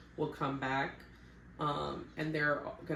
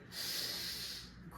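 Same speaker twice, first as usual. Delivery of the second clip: weary sigh; 9 dB quieter than usual. Real, not acted. Unsure what to say.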